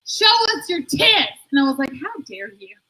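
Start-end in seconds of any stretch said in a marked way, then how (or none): 0.1-1.4 high, drawn-out heckler holler; mock catcall yelled in a raised pitch; imitated yell. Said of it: "one of them yelled out". 1.9-2.8 hushed, indignant mock propriety; soft, scandalized put-on dignity; mock offended lady.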